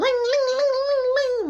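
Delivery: high, loud sing-song ling ling; a high chiming doorbell imitation.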